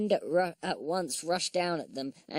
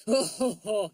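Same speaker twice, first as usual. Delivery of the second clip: loud, high-pitched belly laugh; booming storybook guffaw; roaring laugh; 5 semitones higher, 5 dB louder than usual. A narrator's reading. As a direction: roar out big ho-ho laughs. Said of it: "he roared".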